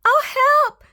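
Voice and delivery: very high, loud squeal; terrified shriek. Acted. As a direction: shriek for help. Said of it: "shivered Horace".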